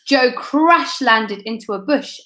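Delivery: usual speaking voice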